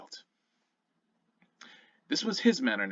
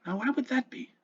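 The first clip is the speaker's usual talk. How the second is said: curious father; 12 semitones higher than usual. Acted. Father gently questioning his son.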